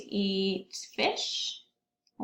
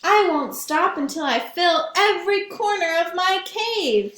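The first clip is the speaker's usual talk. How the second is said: higher and louder, bear swears to fill every corner; determined bear vow; 7 semitones higher, 8 dB louder than usual. Acted. Bear vows to the class he will fill his cave, loudly. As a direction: declare it like a stubborn bear. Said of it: "said Bear".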